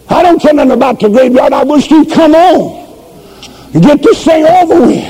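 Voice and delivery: preaching. Acted. Preacher warning the congregation about doubt, fiery. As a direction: warn the flock with full force.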